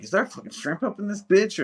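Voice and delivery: high, incredulous mock yelp; a high mock gasp of disbelief at shrimp in the hush puppies; mock shocked diner. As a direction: squeal it like a stunned customer. Mock diner's surprised question.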